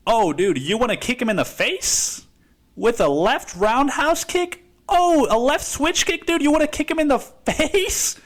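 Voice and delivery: playful hammed-up temptation; tempting inner voice. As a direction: voice the little devil in your head. His inner violent thoughts voiced.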